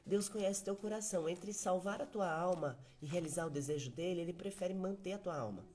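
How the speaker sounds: ordinary delivery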